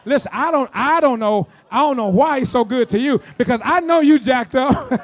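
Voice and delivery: loud, emphatic sermon delivery; punchy, loud pulpit teasing; fired-up preaching. Real, not acted.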